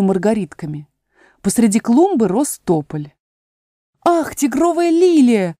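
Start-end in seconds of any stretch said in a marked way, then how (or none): 4.0-5.6 put-on high, slow girlish call to the flower; Alice's delight. A narrator's reading. Alice greeting the tiger lily with delight. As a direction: lift your voice in wonder, slowly. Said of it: "сказала Алиса".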